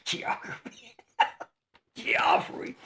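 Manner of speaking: cracking up on the name; breathless, helpless laughing. Real, not acted. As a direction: wheeze the name out through laughter.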